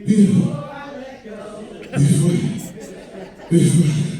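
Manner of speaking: gasping through the word; winded singer breaking off; out of breath. Real, not acted. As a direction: wheeze it out, winded. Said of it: "I'm out of breath".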